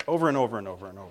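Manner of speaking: very fast, low, run-together mumbling loop; endless loop. Retold or imitated. Parent imitating CDs in the car, endless droning loop. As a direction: rattle it off low like a disc repeating. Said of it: "listening to C Ds in the car goes".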